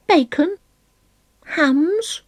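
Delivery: bright and high, breezy; cheerful. Acted. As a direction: toss it off cheerfully. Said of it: "replied Pigwig cheerfully".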